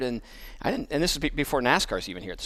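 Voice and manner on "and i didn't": ordinary talk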